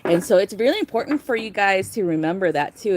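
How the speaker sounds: ordinary talk, nothing marked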